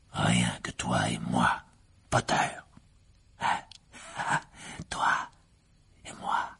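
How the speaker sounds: low, soft gloating with a dark chuckle; menacing whisper. A narrator's reading. Riddle savours being alone with Harry, low and soft. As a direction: relish being alone with your victim.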